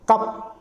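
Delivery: कप said as kup, short a; word demo. Shown as a demonstration. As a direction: say कप with a short clipped vowel. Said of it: "सीयूपी".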